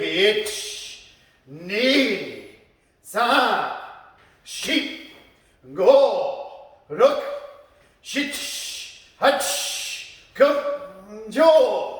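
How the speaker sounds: slow, drawn-out martial arts count. Shown as a demonstration. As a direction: count each strike slowly, with pauses between. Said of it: "slowly punching".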